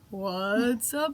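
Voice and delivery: hammed-up host call; mock announcer build-up; game show host voice. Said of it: "I realized that as I was saying it".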